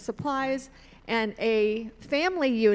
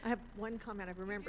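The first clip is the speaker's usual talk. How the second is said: off mic; 15 dB quieter, much faster than usual. Real, not acted. I'll pass the mic.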